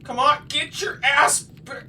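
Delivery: loud, high, drawn-out come on; frustrated shout. Real, not acted. Player chasing the kid, shouting in frustration. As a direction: yell in real frustration.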